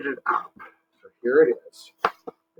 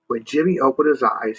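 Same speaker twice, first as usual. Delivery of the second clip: usual speaking voice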